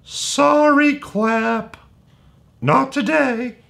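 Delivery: high, squeaky mock sorry; playful apology. Teacher playfully apologizing to the kids, high and squeaky. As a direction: pop the voice way up in a silly apology.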